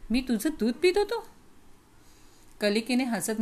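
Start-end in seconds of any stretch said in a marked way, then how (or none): 0.1-1.3 put-on child's question pitched up in disbelief; astonished question. A narrator's reading. Young prince asks the maid, stunned and disbelieving. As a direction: ask it wide-eyed, stunned. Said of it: "मी चकित होऊन प्रश्न केला".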